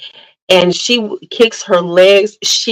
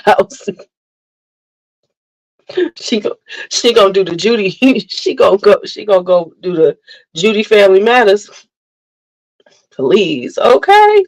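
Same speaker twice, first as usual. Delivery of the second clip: usual speaking voice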